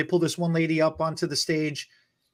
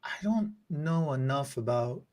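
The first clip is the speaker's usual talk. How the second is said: whispered, thinking aloud; half to herself, unsure of the answer; 5 semitones lower, 10 dB quieter than usual. Real, not acted. Guest unsure of the quiz answer, whispering.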